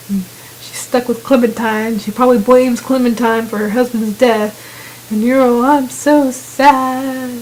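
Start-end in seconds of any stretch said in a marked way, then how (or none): 5.6-7.3 drawn-out mock-sad whine. Teasing the player's reaction to Christina's losses, drawn-out mock sadness. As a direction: stretch it out in pretend misery.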